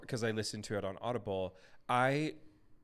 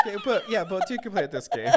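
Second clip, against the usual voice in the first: high, loud, through real laughter; laughing; 8 semitones higher, 7 dB louder than usual. Real, not acted. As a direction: talk while still cracking up. Laughing at the friend's voice attempt.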